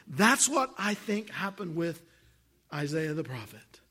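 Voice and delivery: usual speaking voice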